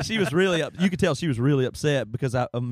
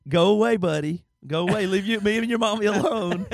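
mock dad shooing; 6 semitones higher than usual. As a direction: parody the dad shooing the dog away. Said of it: "next time you say".

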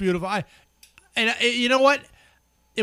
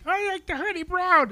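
high put-on mimic voice; mocking imitation; 9 semitones higher than usual. Teasing a friend over his taste in beer, high mocking imitation. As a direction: parrot him mockingly, pitched way up.

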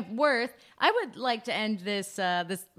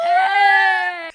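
playful shout; 10 semitones higher, 13 dB louder than usual. Real, not acted. Playful sign-off outburst.

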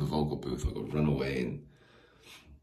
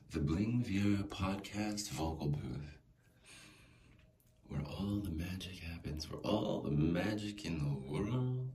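announcer voice; 4 semitones higher, 4 dB quieter, slower than usual. Pretend. Announcing the booth grandly.

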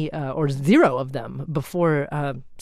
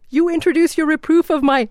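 teasing; 10 semitones higher, 5 dB louder than usual. Retold or imitated. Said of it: "she joked back".

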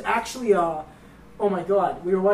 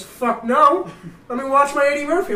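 mock annoyed guard, higher; 7 semitones higher, 6 dB louder than usual. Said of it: "He goes".

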